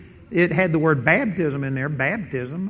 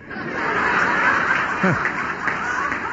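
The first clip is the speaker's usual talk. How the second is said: high breathy laugh. Real, not acted. Laughs at own joke.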